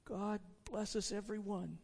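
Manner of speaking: lightly voiced small child. Tiny Tim echoes his father's toast, soft child voice. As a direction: bless them softly like a little boy. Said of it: "said Tiny Tim".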